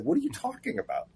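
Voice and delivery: distraught. Retold or imitated. Writer retelling his reaction to losing Flash, mock distraught. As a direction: retell a dismayed reaction in a squeaked half-voice. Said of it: "And I was distraught".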